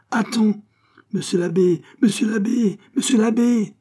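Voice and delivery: high, loud, rising panic; panicked pleading. Acted. Pitou fleeing the abbé's whip, high panicked pleas. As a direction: beg him to stop, backing off.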